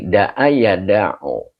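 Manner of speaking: da'a ya da'u drawn out very slowly; slow sounding out. Shown as a demonstration. Teacher sounding out verb forms for the class. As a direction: spell out da'a and da'u syllable by syllable. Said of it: "goresan hati".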